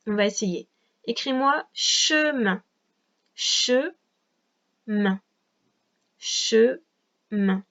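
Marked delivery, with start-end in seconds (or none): 1.7-2.6 "chemin" drawn out slowly as che, then min; long, separated syllables in "chemin"; syllable-by-syllable dictation. Shown as a demonstration. Dicte un mot par syllabes. 3.3-7.6 "Chemin" very slowly, long gap between che and min; each syllable of "Chemin" isolated and stretched; syllable-by-syllable dictation. Shown as a demonstration. Répète la dictée par syllabes.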